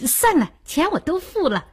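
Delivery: amused, lifted voice after a chuckle; laughing reply. Acted. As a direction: laugh it off lightly. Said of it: "艾丽笑道".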